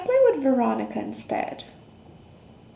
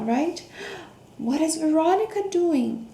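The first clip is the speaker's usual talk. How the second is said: ordinary delivery, nothing marked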